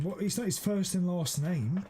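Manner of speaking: ordinary talk, nothing marked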